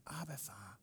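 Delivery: soft, whispered call to a father; hushed cry to God. Retold or imitated. Believers calling on God as father, whispered. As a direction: whisper it tenderly, far below talking level. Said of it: "Og i den råber vi".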